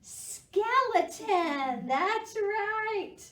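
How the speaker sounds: high, loud cheer; excited praise. Real, not acted. Teacher cheering kids for guessing right.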